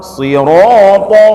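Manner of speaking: "Sirata" chanted slowly with heavy rounded "ro"; thick ra. Shown as a demonstration. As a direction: draw out a full, dark r in "Sirata". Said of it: "ro tebal".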